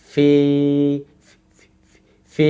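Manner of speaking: ফি held long on a steady note; stretched vowel. Shown as a demonstration. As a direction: sustain the i of ফি for two beats. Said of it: "এক second মতো টেনে বলবো".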